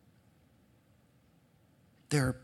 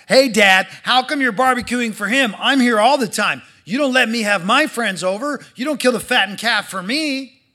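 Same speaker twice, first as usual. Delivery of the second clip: loud, high complaining protest; a jealous son airing his grievance; indignant older brother; 5 semitones higher, 7 dB louder than usual. Acted. Older brother protesting to his father, loud and indignant.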